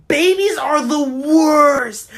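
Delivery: drawn-out, high, very loud yell; loud outburst. Real, not acted. Rant about hating babies, sudden loud outburst. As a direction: explode into a long, loud complaint.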